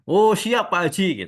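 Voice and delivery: high, loud, eager retold agreement; playing along. Tailor humouring the puzzling client, retold eager compliance. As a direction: go along with him briskly. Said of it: "saya iya-iyain aja".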